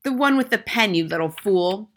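loud, high, impatient retort; scornful schoolmistress; tyrannical headmistress, exasperated. A narrator's reading. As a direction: snap it loudly at the boy.

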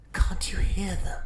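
high eerie whisper. Acted. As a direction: whisper it like a secret.

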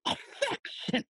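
high, incredulous squeak; mocking disbelief; scornful echo. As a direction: throw the word back in high-pitched mockery. Father scoffs at daughter's word.

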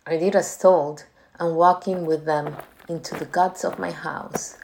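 plain reading voice

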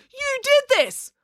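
high, slow, accusing cry; screaming accusation. Acted. Animesh blaming April for the dead cockroach, shrill. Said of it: "screamed Animesh".

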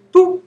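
high, bouncy "boop" noise; mock boop sound. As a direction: pop it out like a cartoon bump. Devil knocks you off fence.

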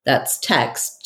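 usual speaking voice

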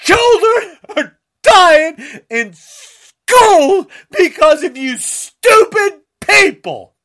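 usual speaking voice

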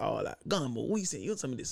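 low, soft, coaxing offer; low older youth. Retold or imitated. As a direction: tempt the kid quietly.

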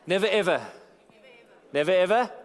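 insistent repeated plea; emphatic never ever. Real, not acted. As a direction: hammer home never and ever. Preacher pleading with the congregation.

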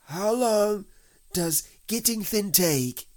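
high, loud, slow worried question; anxious. A narrator's reading. Pooh must wait to get thin, anxious. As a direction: ask with rising worry, pitch well up. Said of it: "asked Pooh anxiously".

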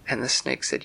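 usual speaking voice

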